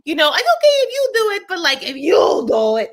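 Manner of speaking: very high, exaggerated mock disapproval; hammed-up shrieky judging of someone; judgmental. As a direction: squeal the verdict in imitation. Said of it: "like, judgmental".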